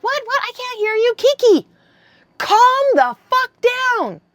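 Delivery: high, loud mock exasperation; exasperated brother-in-law. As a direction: imitate yelling down the phone, drawn out at the end. Retelling brother-in-law's exasperated reply.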